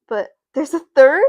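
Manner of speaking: a loud, startled question; surprised. Real, not acted. Streamer spotting a third viewer, loud surprise. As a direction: react to a third viewer with alarm.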